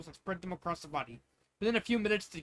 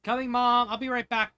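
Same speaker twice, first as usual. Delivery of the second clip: calling back; 6 semitones higher, 9 dB louder than usual. Acted.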